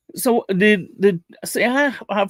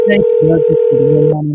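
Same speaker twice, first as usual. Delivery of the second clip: loud relieved thanks; 13 dB louder than usual. Real, not acted. Relieved after phone menu.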